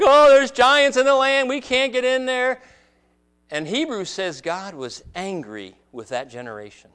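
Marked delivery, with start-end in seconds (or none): 0.0-2.6 higher and louder, in mock panic; scared spies. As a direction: play the frightened spies reporting giants. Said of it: "they came back".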